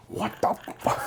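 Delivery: hushed stunned what the fuck. Retold or imitated. Dad jolted awake from the nightmare, hushed disbelief. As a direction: breathe it out, shocked and half-voiced. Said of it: "he wakes up and is like".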